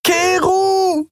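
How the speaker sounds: very high, drawn-out cry of a name; screaming. A narrator's reading. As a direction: scream after someone leaving. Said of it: "schrie sie". (lang gezogener, hoher Schrei)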